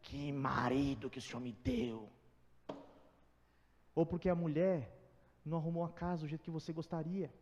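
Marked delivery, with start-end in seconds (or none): 0.0-2.1 muttered complaint. Pretend. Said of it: "aí vai dobrar o joelho".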